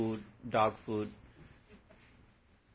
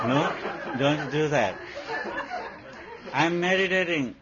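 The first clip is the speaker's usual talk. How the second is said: higher, louder, laughing mock complaint; mock exasperation; 4 semitones higher, 6 dB louder than usual. Owner laughing, shooing the barking puppy while meditating.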